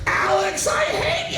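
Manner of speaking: high loud mock yell; playful shout. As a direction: shout it across the room. Shouting across the stage.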